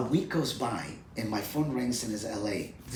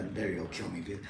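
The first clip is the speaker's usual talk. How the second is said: ordinary talk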